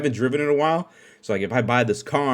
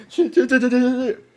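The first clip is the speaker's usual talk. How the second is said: high, loud rapid-fire cursing; mock panic; 11 semitones higher, 6 dB louder than usual. Rider fearing a gun behind him, panicked. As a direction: play frantic escape on repeated curses. Acts fleeing a feared gun.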